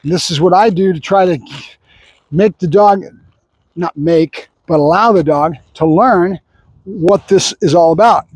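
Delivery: ordinary delivery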